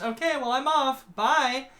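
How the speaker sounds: high, bright mock farewell; breezy goodbye. Guardian escaping an awkward chat, breezy goodbye. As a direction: toss out a chirpy exit.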